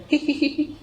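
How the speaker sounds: high-pitched mock "hee-hee" giggle; imitated giggle. Teacher mimicking a student's laughing reaction, mock hee-hee giggle. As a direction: fake a squeaky little giggle, well above normal pitch. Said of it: "হাসি দিয়েছেন".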